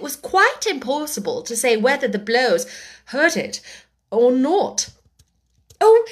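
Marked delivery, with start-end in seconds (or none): 5.8-6.0 put-on very high, frantic girl's cry; terrified cry. A narrator's reading. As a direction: cry out in panic. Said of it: "cried Alice ... agony of terror".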